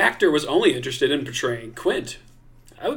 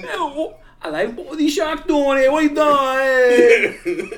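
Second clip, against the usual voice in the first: mock mobster; 12 semitones higher, 6 dB louder than usual. Mobster bit revived.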